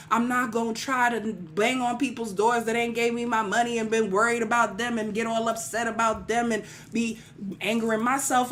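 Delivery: usual speaking voice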